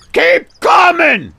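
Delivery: long loud high shout; calling out. Acted. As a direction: holler it across the docks, drawn out. Directing a reversing ship at docks.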